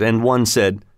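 ordinary delivery

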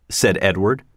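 ordinary delivery, nothing marked

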